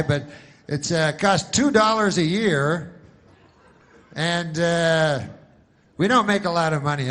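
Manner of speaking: loud, high, hammed-up commercial pitch; insurance ad pitchman. Pitchman pitching insurance to very old people, loud hammed-up sales voice. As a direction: belt it out like a TV spokesman.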